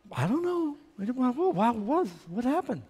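put-on high, halting little-boy voice; feigned innocence.

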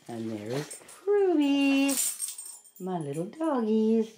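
high, slow cooing at pets. Real, not acted. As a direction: stretch it out sweetly for the dogs. Cooing at her dogs.

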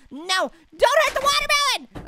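high, pleading cry for the fruit; high mock plea to save the watermelon. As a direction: beg them to spare it. Pleading in game.

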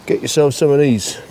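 usual speaking voice

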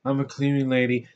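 slow, soft, vacant monotone; mocking a wooden actress, slowly; emotionless actress impression. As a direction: drone it out with no emotion at all. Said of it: "she's like that. She doesn't have an ounce of emotion".

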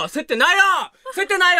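flustered "not panicking" protest; flustered denial. Real, not acted. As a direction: insist you're calm while clearly rattled. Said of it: "焦ってるよ！".

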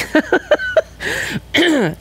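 high giggle cracking into a strange sound; a real giggle that breaks oddly; laughing at herself mid-story; giggle gone odd. Real, not acted. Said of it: "that was a giggle ... crazy sound".

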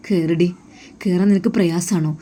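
plain reading voice

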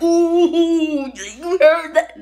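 loud, high, drawn-out hyped reaction; mock impressed hype. Mimicking a fan impressed by a diss, mock hype. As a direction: play an over-impressed crowd member, stretching it out.

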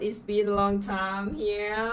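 ordinary delivery

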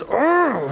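put-on high whiny kid groan; mock exasperated ugh; frustrated groan. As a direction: flop back and groan like a bored kid. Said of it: "and he goes".

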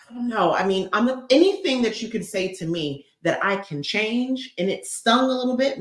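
ordinary talk, nothing marked

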